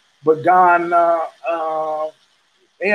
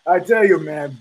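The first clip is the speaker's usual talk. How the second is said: usual speaking voice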